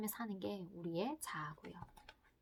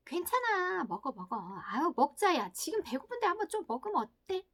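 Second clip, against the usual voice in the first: tempting id voice; 6 semitones higher than usual. Acted. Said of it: "하는 게 이드라고 하죠".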